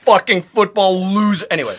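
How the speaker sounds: usual speaking voice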